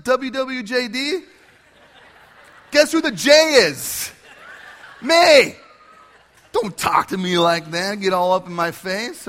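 hammed-up sassy clapback; sassy comeback. A mock Jesus sassing Martha back in a loud high voice. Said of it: "Does Jesus say".

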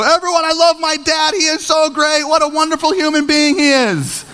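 high, sustained proclamation; hammed-up adoring child hollering out; calling out the window. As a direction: announce it to the whole neighborhood. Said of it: "Let me open up some window".

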